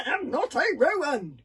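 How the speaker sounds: loud gasping protest. A narrator's reading. Hiccup cornered against the wall, loud gasping protest. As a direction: gasp it, back against the wall. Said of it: "gasped Hiccup".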